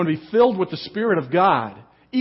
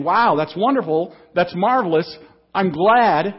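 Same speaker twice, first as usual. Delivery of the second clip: louder, lightly acted joyful outburst; mock delight; 4 semitones higher, 7 dB louder than usual. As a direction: act out the glad reply he never gave, a bit louder. The reply Zechariah should have given.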